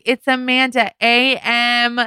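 high, slow, over-enunciated name announcement; drawn-out, high-pitched call, mock desperate; calling out own name. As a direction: act out spelling your name for strangers. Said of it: "Like that. Those were my nightmares".

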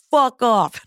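high-pitched mock-angry send-off; hammed-up angry dismissal; acted-out hang-up line; dismissive telling-off. Acted hang-up on suspected scammer.

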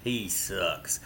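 low, quiet blunt dismissal; deadpan low grumbled verdict; John McClain impression. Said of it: "I can do a good McClain impression".

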